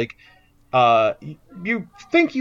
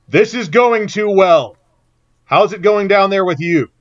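raised, louder, higher voice; calling from shouting distance; 6 semitones higher, 9 dB louder than usual. Acted. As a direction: call across a big room to an ally. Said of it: "come within shouting distance and goes".

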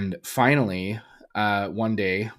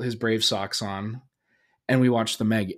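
usual speaking voice

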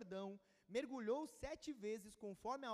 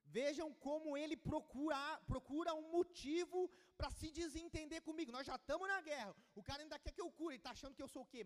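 high-pitched, worked-up complaint; indignant king; 5 semitones higher, 4 dB louder than usual. Acted. King complaining that Syria seeks a pretext for war, indignant. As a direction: protest it as an aggrieved king.

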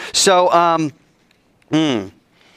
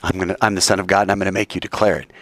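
quick mock aside; 7 semitones lower, much faster than usual. Preacher says what Jesus never said, fast and dismissive. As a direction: toss it off quickly and low. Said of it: "Jesus over here never says".

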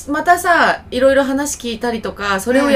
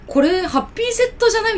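much higher, puzzled wondering aloud; surprised realization; 11 semitones higher than usual. Retold or imitated. Retelling noticing the Happy Meal on the order, surprised. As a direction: catch yourself noticing in surprise. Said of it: "みたいな".

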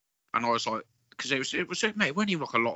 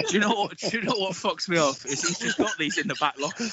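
laughing; 8 semitones higher than usual. Real, not acted. Laughing while speaking.

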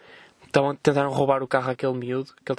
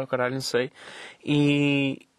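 ordinary talk

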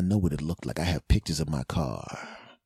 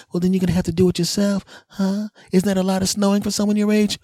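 put-on fast, high smart-aleck kid; mocking kid voice; 12 semitones higher, 4 dB louder, faster than usual. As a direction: taunt him like a snotty teen. Kids taunting him, voiced.

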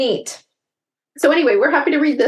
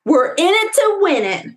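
put-on fired-up boys cheering together; excited cheer; 9 semitones higher than usual. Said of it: "the brothers cried".

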